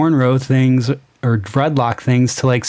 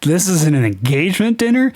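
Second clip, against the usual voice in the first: higher, amused mock question; incredulous quoting; 4 semitones higher than usual. Reviewer rereading his line to show the leap, laughing incredulously. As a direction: reread it as if it were absurd. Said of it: "He says".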